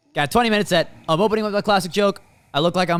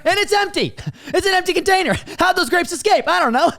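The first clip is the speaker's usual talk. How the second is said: baffled; 13 semitones higher than usual. Acted. Comic bafflement building.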